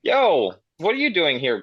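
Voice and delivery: ordinary talk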